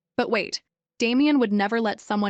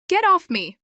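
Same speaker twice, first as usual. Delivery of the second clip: sharp, loud and higher; angry shout; 9 semitones higher, 5 dB louder than usual. Acted. Arya yelling at Damien to get off her, loud angry shout. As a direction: shove the words at him full force. Pinned on bed, pushing him off.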